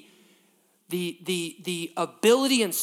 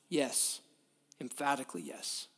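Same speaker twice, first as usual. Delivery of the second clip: soft emphatic yes; 4 semitones lower, 10 dB quieter than usual. Real, not acted.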